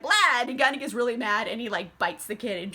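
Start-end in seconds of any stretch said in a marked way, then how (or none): 0.0-0.4 a loud, higher mock blah; mock outburst. Louis fed up with the kid, loud mock blah. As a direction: blurt it out like a fed-up vampire. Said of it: "he just is like".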